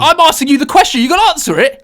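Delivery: high, loud insistence; demanding an answer at full voice; exasperated shout. Real, not acted. Mock quarrel over answering the question.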